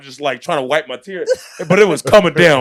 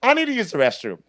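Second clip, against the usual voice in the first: higher-pitched mock passenger voice; imitated lady passenger; 7 semitones higher, faster than usual. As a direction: mimic a woman piping up with a request.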